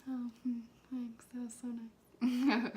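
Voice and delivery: slow, hushed mock-sweet thank-you; a slow imitated whisper of thanks. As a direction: whisper it like a shy girl thanking him. Girl's sweet thank-you, retold.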